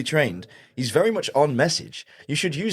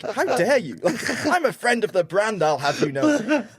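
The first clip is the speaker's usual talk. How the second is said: laughing, hammed-up outrage; mock indignation; 8 semitones higher than usual. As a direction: play at being offended, laughing through it. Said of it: "And I'm like".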